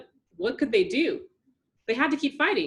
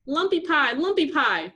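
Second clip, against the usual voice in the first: taunting; 6 semitones higher, 5 dB louder than usual. A narrator's reading. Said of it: "the monster taunted".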